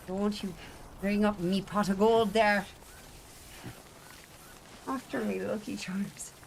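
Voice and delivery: put-on light Irish leprechaun accent. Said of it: "prove it by doing the voice".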